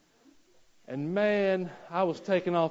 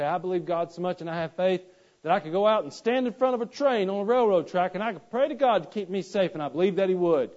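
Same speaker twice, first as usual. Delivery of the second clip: mocking a naive believer; 4 semitones higher, 6 dB louder than usual. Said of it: "They say".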